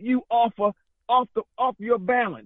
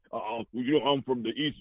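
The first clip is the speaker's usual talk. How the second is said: mocking imitation; 9 semitones lower, 4 dB quieter than usual. Mocking DNA-test believers.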